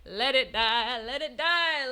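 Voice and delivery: loud, playful chant; mock-dramatic plea. Streamer begging chat to drop the hype train, mock-dramatic. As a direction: cry it out in mock despair.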